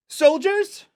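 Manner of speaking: put-on loud, very high child's call; high-pitched shout, rising at the end; expectant summons. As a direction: call the troops and expect an answer. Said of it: "looks over her shoulder expectantly".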